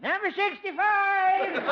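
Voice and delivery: slow, high, loud call with a laugh; calling out a number. Acted. Clerk Clete calling Harold's number, slow and loud. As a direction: announce the number like a busy office.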